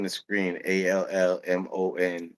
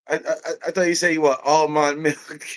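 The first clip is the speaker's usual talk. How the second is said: higher Jamaican voice, stammering; Jamaican accent; 7 semitones higher, 3 dB louder than usual. Retold or imitated. Employee repeating the misheard order, amused Jamaican accent. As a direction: stammer it in Jamaican, laughter creeping in. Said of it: "he's Jamaican".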